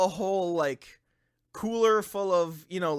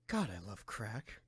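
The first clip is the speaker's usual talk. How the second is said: low, flat murmur imitating a friend; low deadpan mutter; 9 semitones lower, 14 dB quieter than usual. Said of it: "just randomly hearing you".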